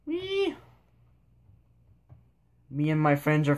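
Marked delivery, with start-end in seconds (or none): 0.0-0.5 very high, squealed wee; gleeful wee. Real, not acted. Playing with the camera in the game, high squeal of glee. Playful cheer while flying around.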